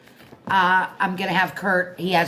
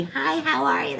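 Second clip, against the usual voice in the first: high, little blonde squeak; mock squeaky greeting; 10 semitones higher than usual. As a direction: put on a squeaky, much higher voice. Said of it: "That little blonde squeaky voice".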